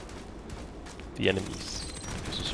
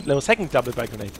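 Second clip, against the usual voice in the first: ordinary talk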